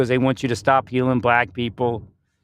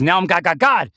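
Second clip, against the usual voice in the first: pitched up, rising on each God; growing proclamation; 6 semitones higher, 3 dB louder than usual. Retold or imitated. Quoting God as he grows bigger, rising. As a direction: build it up higher. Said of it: "This is".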